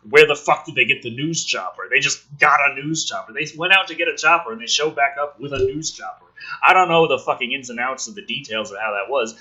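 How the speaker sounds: usual speaking voice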